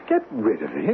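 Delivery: can't believe what he's hearing; a startled, high question; incredulous echo. Acted. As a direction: pitch shoots up in disbelief. Incredulous at advice to destroy box.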